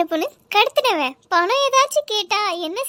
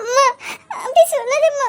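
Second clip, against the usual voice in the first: high, wounded begging; hurt plea; 5 semitones higher than usual. Acted. Daughter begs her mother not to reject her, hurt. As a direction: plead against the harsh words.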